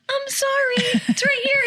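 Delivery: very high, squeaky nervous apology; high flustered apology to the cop. Retold or imitated.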